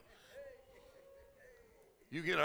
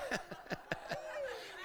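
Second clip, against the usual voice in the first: very high, soft giggle; a light, joyful burst of laughter; soft ecstatic laugh; 7 dB quieter than usual. Real, not acted. Laughing in the Holy Ghost.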